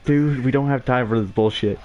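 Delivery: a mock fed-up gamer yelping in frustration; exasperated outburst. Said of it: "And you're like".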